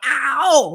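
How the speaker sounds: high retold yelp; mock ow. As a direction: yelp at the grabbed arm. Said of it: "And I went".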